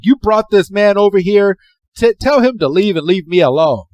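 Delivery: hammed-up yelling creature; mock yelling. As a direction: scold her for bringing him. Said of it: "Pikachu is just yelling".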